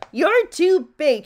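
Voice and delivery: high, loud, drawn-out scolding; bossy cry. A narrator's reading. Little Miss Bossy bumps into Mister Greedy, high loud cry. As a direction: cry out slowly in loud disapproval. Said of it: "she cried".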